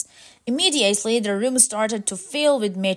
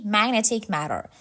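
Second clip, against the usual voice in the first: plain reading voice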